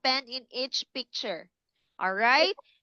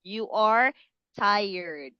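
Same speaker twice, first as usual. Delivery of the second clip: usual speaking voice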